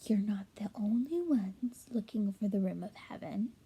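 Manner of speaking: soft secretive whisper. Acted. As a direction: lean in and breathe the secret.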